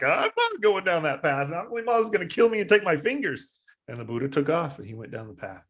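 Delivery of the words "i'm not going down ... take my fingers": scared monks. Retold or imitated. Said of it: "They all were like".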